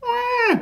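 very high, drawn-out wail; squeaky animal wah; baby seal cry. A narrator's reading. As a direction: bleat like a lost baby seal. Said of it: "said the seals".

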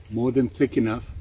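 ordinary delivery

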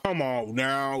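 ordinary delivery

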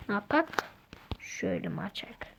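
ordinary talk, nothing marked

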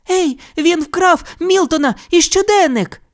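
high, urgent bunny cry; Judy's cry. A narrator's reading. Judy cries out that Milton was stolen. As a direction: shout the alarm in a squeaky voice. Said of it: "скрикнула Джуді".

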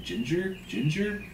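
drawn-out questioning, lifting high at the end; slow, incredulous. A narrator's reading. Stubb approaching the astonished steward, slowly incredulous. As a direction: repeat it slowly in rising disbelief. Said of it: "slowly saying".

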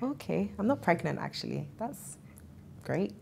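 soft and flat under the breath; deflated sarcasm. Retold or imitated. After another negative test, soft deflated sarcasm. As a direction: let the air go out of you. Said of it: "it's like".

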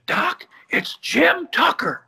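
high, slow rasping strain; raspy voice. Retold or imitated. Wounded caller rasping his name on the phone. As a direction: croak it out like a wounded man. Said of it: "I'll dispense with the raspy voice".

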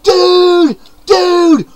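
high, loud surfer-dude yell of Dude; excited shout. Acted. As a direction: holler it like a party greeting. Opening character greeting, shouted.

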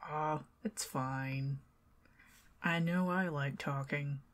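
ordinary talk, nothing marked

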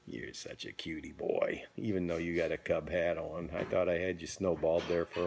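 soft, breathy near-whisper; sleepy bedtime talk. Real, not acted. Bedtime goodnight to grandson.

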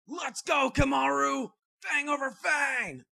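high, loud attack call; anime battle cry. Acted. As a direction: shout it into battle.

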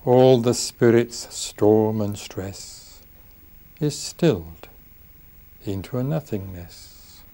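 slow, measured poetry reading with long pauses; unhurried verse, each phrase set apart by silence; poem recital. Acted. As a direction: recite it solemnly, lingering on each line. Reads his own poem aloud.

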